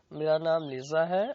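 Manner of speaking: usual speaking voice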